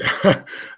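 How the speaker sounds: quick breathy laugh at himself; short laugh. Real, not acted. Commentator laughing at his own frustration, a short laugh.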